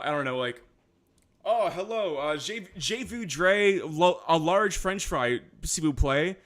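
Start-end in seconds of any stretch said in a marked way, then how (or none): 1.4-6.4 lightly mocking New Brunswick Franglish, higher and slow; a hint of Franglish English with French words dropped in, higher and slow; Franglish imitation. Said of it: "they'll speak like that".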